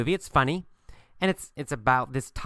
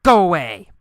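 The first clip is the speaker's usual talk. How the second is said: loud, raised-pitch shout; forceful shouted command; yell; 5 semitones higher, 9 dB louder than usual. Acted. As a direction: yell it to drive someone off. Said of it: "I'll yell at him".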